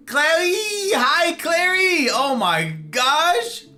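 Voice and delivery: high, slow excited squeal; excited greeting. Real, not acted. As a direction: squeal with delight at a friend.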